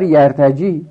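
یرتجی، یرتجیان، یرتجونه called out high and loud; reciting forms. Shown as a demonstration. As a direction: announce the forms to the class. Teacher reciting the paradigm.

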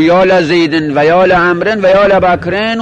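لزیدن، لعمرن، لبکرن all begin with "la"; fatha on every lam. Shown as a demonstration. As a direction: give لعمرن and لبکرن an open "la". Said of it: "همهٔ لام ها را فتح بیار".

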